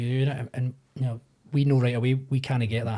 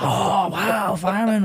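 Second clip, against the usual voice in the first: mock kid amazement; 6 semitones higher, 3 dB louder, much slower than usual.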